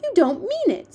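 put-on high, loud little-girl exclamation; shocked cry. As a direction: cry out in horrified disbelief. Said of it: "Sophie cried".

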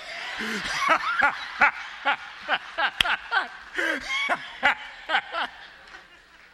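loud high burst of real laughter; real delighted laughter; big laugh. Real, not acted. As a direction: laugh out loud at your own joke. Preacher laughs at own joke.

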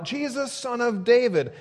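high-pitched retold cry. Blind man as Jesus passes by, crying out. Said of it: "he starts crying out".

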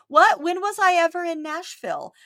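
high, loud fake outrage at What; mock innocent denial. Host mimics a lying guest, high and indignant. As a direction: play the liar caught out, high and indignant. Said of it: "when they say".

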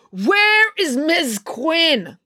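high, slow, furious; girl confronts the substitute, seething; seething demand. A narrator's reading. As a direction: spit each word out slowly. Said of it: "April was seething".

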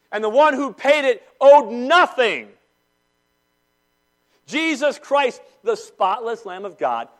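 loud, high, impassioned sermon; preaching at full force. Real, not acted. Pastor thundering to his church about debt. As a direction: drive it home to the congregation.

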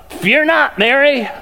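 lightly voiced angel proclaiming; angel's announcement. Gabriel greeting Mary, a hint of a proclaiming angel. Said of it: "first words out of Gabriel's mouth".

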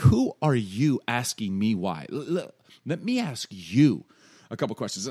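slow, loud, challenging comeback; God. Acted. God answering Job's why, slow sarcastic challenge. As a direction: turn the question back with heavy emphasis. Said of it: "almost like God's getting sarcastic".

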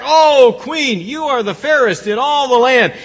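high, loud gushing praise; flattering mirror voice. Retold or imitated. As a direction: gush it like a fawning mirror. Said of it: "The mirror always says back".

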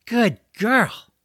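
high, loud pet-praising voice; gushing praise. Acted. George rubbing the dragon's ears after the rescue, gushing.

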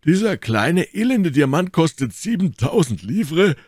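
high, loud disbelieving exclamation; astonished cry. A narrator's reading. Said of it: "rief Athos". (erstaunter, hoher, lauter Ausruf)

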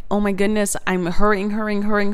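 ordinary delivery

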